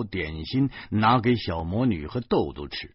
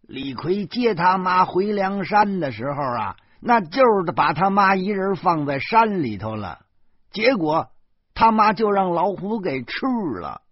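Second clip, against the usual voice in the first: put-on higher, louder old woman, righteous; indignant old mother; 10 semitones higher, 5 dB louder than usual. Old mother insisting to her son, higher and louder in righteous indignation. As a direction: stamp your foot like a stubborn old mum. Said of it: "老妈理直气壮地跺着脚说".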